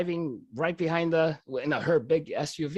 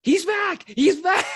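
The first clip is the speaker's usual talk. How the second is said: much higher, louder excited shout; yelling; 13 semitones higher, 7 dB louder, slower than usual. Retold or imitated. Young paramedic yells to the crew up front, excited shout. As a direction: yell it to the front of the ambulance. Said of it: "he starts yelling to the front guys".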